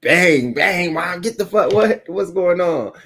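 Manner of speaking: high, loud and startled, dragging the last words slowly; startled yelp. Retold or imitated. A kid jolted awake, yelling at mom in shock. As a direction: jolt awake confused and loud.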